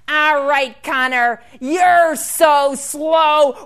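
very loud, drawn-out taunt; bully. Acted. Bully Dirk standing over shoved Connor, loudly mocking. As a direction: jeer it, stretching the insult. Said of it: "And then Dirk shoved him".

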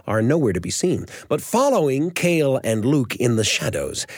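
ordinary delivery, nothing marked

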